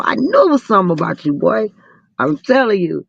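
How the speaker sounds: ordinary delivery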